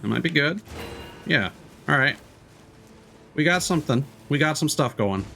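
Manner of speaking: usual speaking voice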